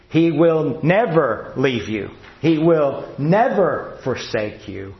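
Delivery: "never" leaned on, slow and steady; stress on never. Acted. Preacher assuring believers, slow stress on never. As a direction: land each "never", slowly.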